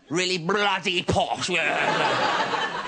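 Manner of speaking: slow hammed-up upper-class English vowels; mock posh. Mocking his mate's posh girlfriend, a slow posh accent. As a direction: stretch it out like a royal snob. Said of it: "really posh".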